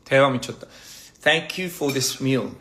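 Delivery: very slow, deliberate English. Shown as a demonstration. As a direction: spell it out slowly. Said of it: "How do I say".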